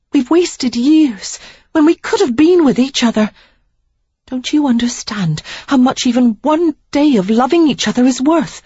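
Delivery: Sarah, pleading. Acted. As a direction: beg him to see what they are wasting. Sarah's lines, higher voice.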